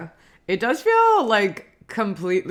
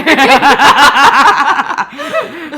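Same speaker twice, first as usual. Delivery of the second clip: loud laugh; 10 semitones higher, 12 dB louder than usual. Real, not acted. Laughing at being whispered.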